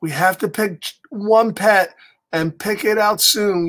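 ordinary delivery, nothing marked

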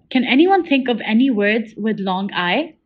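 ordinary delivery

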